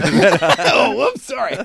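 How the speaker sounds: amused, laugh-filled oops; laughing mock apology. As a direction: apologise through laughter as a joke. Joking mock apology while laughing.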